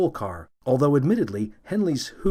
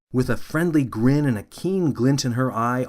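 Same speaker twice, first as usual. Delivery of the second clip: plain reading voice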